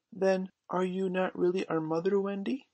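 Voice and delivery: put-on high-pitched child's puzzled question; sleepy little boy. A narrator's reading. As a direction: ask in a high little voice, drowsy. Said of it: "who was surely sleepy".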